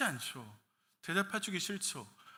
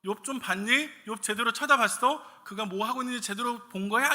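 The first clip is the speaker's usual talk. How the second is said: God challenging; 6 semitones higher, 7 dB louder than usual. Acted. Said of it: "하나님께 사탄에게".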